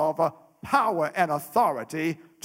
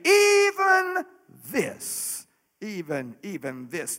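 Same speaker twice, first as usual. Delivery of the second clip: preaching cry fading; slower than usual. Acted. Preacher builds to climactic cry.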